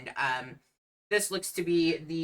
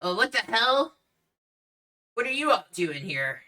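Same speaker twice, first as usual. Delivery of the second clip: lightly voiced startled bartender, higher at first; 6 semitones higher, 4 dB louder than usual. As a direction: react as a bartender catching intruders. Bartender notices the intruders.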